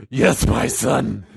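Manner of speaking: slow demonic growl; slow, rough Batman-like God; super scary God. Acted. God answers his frightened son, slow scary growl. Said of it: "God's just super scary".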